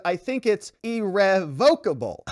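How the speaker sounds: irrevocable mangled as ir-rev-VOKE-able; muddled pronunciation. Retold or imitated. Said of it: "hard to tell which syllable".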